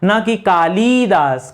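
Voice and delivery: ना कि कालिदास said slowly, as the wrong form. Shown as a demonstration. Teacher contrasting wrong Kalidas with the right one. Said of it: "सही होता है कालिदास".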